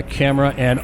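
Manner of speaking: ordinary delivery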